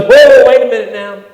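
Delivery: loud, high burst of alarm; loud protest. Retold or imitated. Peter loudly refusing to let Jesus die. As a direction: blurt it out like a startled friend.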